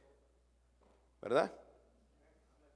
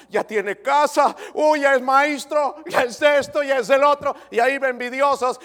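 full-force preaching; 8 semitones higher, 12 dB louder than usual. Acted. Pastor stirring the church about envious hearts, preaching loud. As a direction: preach it out hard to the congregation.

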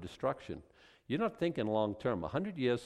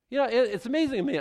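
excited; 13 semitones higher, 8 dB louder, faster than usual. Real, not acted. Excited burst.

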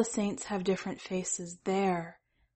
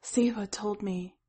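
plain reading voice

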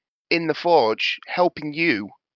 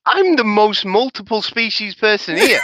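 louder, higher mock protest; mock indignant; 5 semitones higher, 7 dB louder than usual. Real, not acted. Player protesting to the table, mock indignant. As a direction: protest in jest, half laughing. Defending himself loudly in jest.

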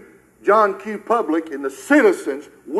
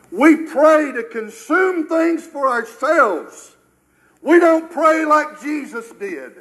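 fiery preaching; 4 semitones higher, slower than usual. Acted. Preaching build on prayer.

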